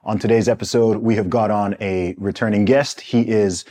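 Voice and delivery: fast, loud hype-man welcome; grand entrance announcement. Acted. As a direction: announce the guest like a show host. Podcast host opening intro.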